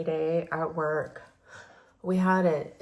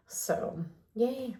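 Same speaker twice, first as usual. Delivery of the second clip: hoarse, losing her voice; faint raspy near-whisper; 5 semitones higher, 5 dB quieter than usual. Real, not acted. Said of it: "Sorry, I'm losing my voice".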